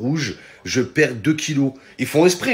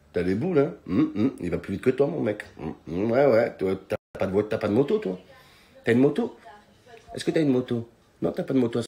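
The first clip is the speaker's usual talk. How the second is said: low, soft sneering tease; mocking taunt; 4 semitones lower, 6 dB quieter than usual. Girlfriend taunting a carless driver, low and soft. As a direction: purr the taunt much quieter and lower.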